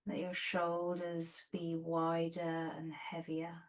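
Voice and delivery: soft, unhurried meditation voice with long pauses; guided meditation. Real, not acted. Yoga teacher settling students onto their bolsters, soft guided meditation.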